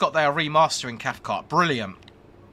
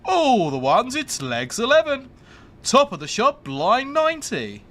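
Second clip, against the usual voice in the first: loud, higher, drawn-out bingo calls; bingo caller; 8 semitones higher, 5 dB louder than usual. Pretend. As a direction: belt it like a bingo hall caller. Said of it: "I would love to be a bingo caller".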